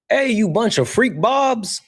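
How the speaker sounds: high mock impression of a streamer friend; imitating Solid. Streamer mimics a friend greeting chat, mock high voice. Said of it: "That's, that's how he, he says it".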